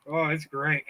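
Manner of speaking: usual speaking voice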